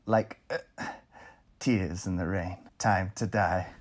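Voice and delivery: slow, hushed dramatic recital; dramatic monologue. Acted. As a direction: deliver it slow and haunting. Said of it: "let's hear it".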